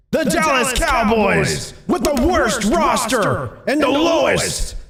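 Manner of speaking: high, slow booming play-by-play call; sports announcer shout. Pretend. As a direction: call it like a championship win on TV. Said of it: "It's like Dallas just won the Super Bowl".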